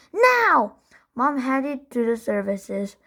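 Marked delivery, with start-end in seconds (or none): 0.1-0.8 high, loud now; Henry demanding a stop; screamed demand. A narrator's reading. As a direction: insist at full volume.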